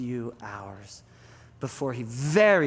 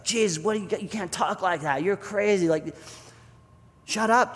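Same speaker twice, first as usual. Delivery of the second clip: higher, exasperated scolding; mild mock rebuke; 6 semitones higher than usual. Peter rebukes Jesus, half-earnest exasperation. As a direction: protest as if he's talking nonsense. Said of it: "he rebukes Jesus".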